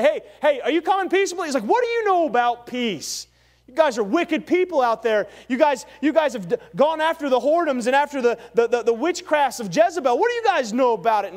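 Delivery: loud, high passionate retort; preacher voicing Jehu scolding the wicked people, loud and high; acted rebuke. Fiery prophet shouting down his enemies. Preacher acts out Jehu's angry reply.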